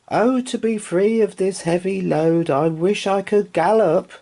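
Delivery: high, longing little horse; wistful horse voice. Acted. Rocking horse longing to trot, high and wistful. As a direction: sigh out the wish high.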